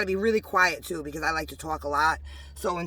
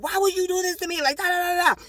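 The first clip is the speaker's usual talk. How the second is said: high-pitched exasperated complaint, trailing into nonsense syllables; retold fury; 11 semitones higher, faster than usual. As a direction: act out pleading outrage, pitched way up. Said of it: "really infuriated".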